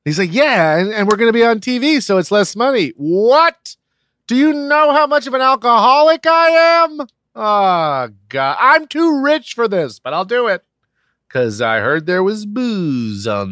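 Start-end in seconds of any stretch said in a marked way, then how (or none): 0.3-2.9 higher, quick sales pitch; voiced friend. Friend pitching the cheap boat, higher and quick. 3.0-10.7 put-on indignant drunk. Drunk guest scoffing at the cheap boat, high outrage.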